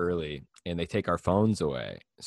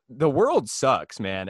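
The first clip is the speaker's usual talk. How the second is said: ordinary delivery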